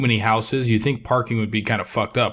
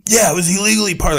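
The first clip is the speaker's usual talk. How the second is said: high, fast, agitated; freaking out; 9 semitones higher, 4 dB louder, faster than usual. Retold or imitated. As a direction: fire it back quickly, upset. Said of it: "he immediately was, like, freaking out".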